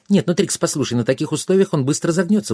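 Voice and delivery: high, fast protest; indignant fairy. A narrator's reading. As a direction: object quickly and hotly. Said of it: "возмутилась фея". (фея торопливо возмущается)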